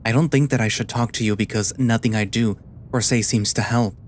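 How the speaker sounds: ordinary delivery, nothing marked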